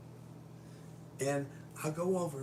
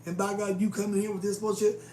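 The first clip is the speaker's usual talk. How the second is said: loud, high, fed-up insistence; defiant shout; 5 semitones higher, 7 dB louder than usual. Retold or imitated. Answering the boss who wants the homeless gone, loud defiance. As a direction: stand your ground hard.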